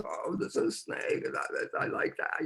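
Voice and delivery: put-on soft breathy little kid charmed by a snake; child's delight. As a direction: whisper it like a fascinated child. Child copying mother's delight.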